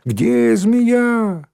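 put-on very high, shrill-child squeal; high-pitched, stretched children's clamour; squealing little kids. A narrator's reading. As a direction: squeal it like excited first-graders. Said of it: "подняли писк и визг".

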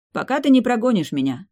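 a snorted, wry promise. A narrator's reading. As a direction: toss it off with a half-laugh. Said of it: "хмыкнул Мик".

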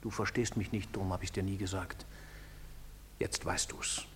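very low, soft voice; low-voiced, subdued confession; hushed admission. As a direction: drop low and quiet, confiding. Bitter private admission to partner.

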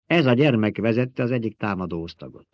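ordinary delivery, nothing marked

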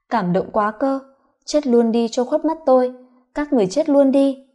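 ordinary delivery, nothing marked

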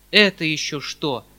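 loud startled cry; exclaiming. A narrator's reading. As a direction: cry out in alarm. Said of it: "вскричал дядя".

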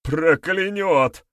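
slow, high, loud frightened cry; fearful cry. A narrator's reading. Frightened youth answers his questioner, fearful cry. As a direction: cry it out in dread. Terrified youth fears curse.

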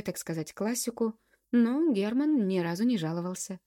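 ordinary delivery

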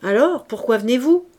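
loud, gruff question. A narrator's reading. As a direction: demand it grumpily, voice pushed up. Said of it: "avec un accent bourru".

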